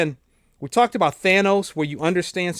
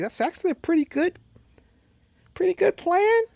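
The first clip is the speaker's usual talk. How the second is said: impressed; 13 semitones higher than usual. Retold or imitated. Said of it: "I was like".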